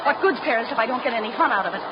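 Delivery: ordinary talk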